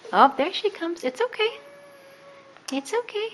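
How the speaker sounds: very high reassuring coo; soothing coo. Real, not acted. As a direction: reassure them up high and light. Soothing as cat approaches.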